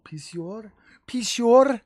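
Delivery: "pee-syur" in a put-on French accent; mock French accent. Shown as a demonstration. Streamer making the name sound cool, mock French accent. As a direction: say it with a jokey French lilt. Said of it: "like, a French accent or some shit so it sounds cool".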